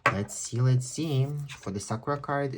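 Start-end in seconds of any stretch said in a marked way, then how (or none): none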